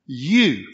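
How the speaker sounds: "You" loud and drawn out; stress on You. Shown as a demonstration. Preacher pointing out the pronoun to the congregation, "You" stressed loudly. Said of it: "notice the pronouns here".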